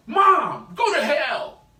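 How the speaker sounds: loud, high angry shout; cursing outburst. Acted. Child pronouncing a curse on mom, angry shout. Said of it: "if I say it like that".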